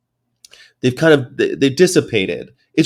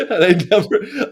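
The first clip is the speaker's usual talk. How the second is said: high laugh; 8 semitones higher than usual. Real, not acted. Cracking up at the story.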